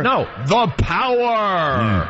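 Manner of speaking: slow, booming echo voice; cave echo. Shown as a demonstration. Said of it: "We're in echo mode".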